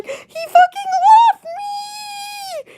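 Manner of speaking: very high, loud crying wail; mock wailing. Imitating the friend crying on the phone, very high. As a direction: wail it like she's been abandoned. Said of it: "she's going".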